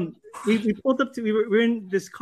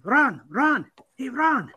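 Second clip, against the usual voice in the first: mock calling out, pitched up; retold yelling; 7 semitones higher, 3 dB louder, much slower than usual. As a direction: imitate hailing someone at a stoplight, half-raised voice. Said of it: "he just started yelling at Ron Howard".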